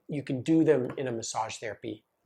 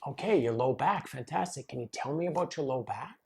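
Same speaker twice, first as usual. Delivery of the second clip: softer, gentle reply matching a nervous client; calm and matched; 6 dB quieter than usual. Retold or imitated. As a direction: ease in quietly, no hype. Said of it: "if I were to meet a person like that".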